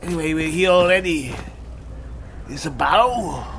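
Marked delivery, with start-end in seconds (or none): none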